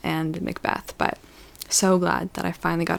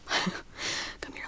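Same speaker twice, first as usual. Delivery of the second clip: breathy playful whisper, much softer; 11 dB quieter than usual. Pretend. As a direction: lean in and whisper it to the viewer. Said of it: "Let me whisper in your ear".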